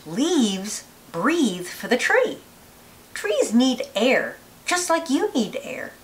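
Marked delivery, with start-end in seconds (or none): none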